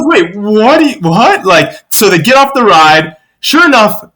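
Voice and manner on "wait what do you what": high, baffled double take; acted confusion, pitched up and rising; mock disbelief. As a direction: imitate a mother who cannot believe it. Mom voiced in disbelief.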